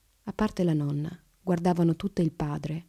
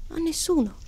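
put-on high, small child's voice; mildly whiny, pitched high; about to whimper; 11 semitones higher than usual. A narrator's reading. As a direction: say it like a little girl near tears. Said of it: "cominciò a piagnucolare".

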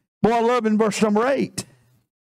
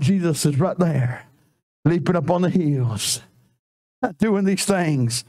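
tender reassurance; 8 semitones lower than usual. Real, not acted. Preacher comforting lonely listeners, tender and low.

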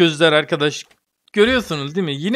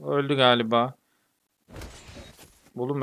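ordinary delivery

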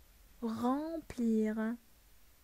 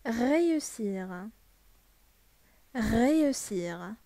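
slow model word; much slower than usual. Shown as a demonstration. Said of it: "Ça veut dire ينجح".

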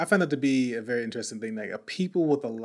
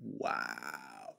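stunned quiet wow; 14 dB quieter than usual. Retold or imitated. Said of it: "I was just like".

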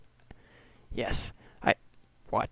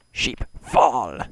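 loud barked order at the sheep; shouted command; 5 semitones higher, 12 dB louder than usual. Real, not acted. Player yelling at sheep in his way, loud command. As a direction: yell it out, commanding them to drop.

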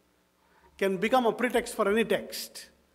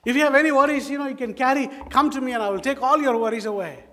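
higher, louder sales pitch; mock commercial; 4 semitones higher, 6 dB louder, faster than usual. Preacher imagines an impossible TV advert, mock commercial. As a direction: proclaim it like an advertised offer. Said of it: "Have you seen any commercial like that?".

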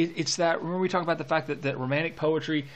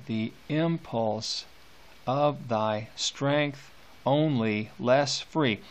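very slow and softer, lingering on less free; slow soft reread; 4 semitones lower, 5 dB quieter, much slower than usual. Shown as a demonstration. Teacher rereading the line for students, slow and hushed. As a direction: draw the line out gently. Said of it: "rereads the line quietly, slowly".

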